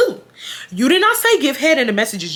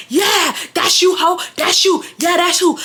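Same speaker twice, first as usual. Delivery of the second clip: retold angry taunt; 7 semitones higher than usual. Brian calling her out publicly, high mocking shout. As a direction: throw the accusation back at her, shouting. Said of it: "So now Brian's like".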